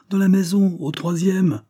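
high, urgent answer; alarmed cry. A narrator's reading. Callers telling the boss where the crime was, alarmed cry. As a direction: call out the news urgently, high and forceful.

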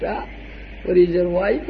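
usual speaking voice

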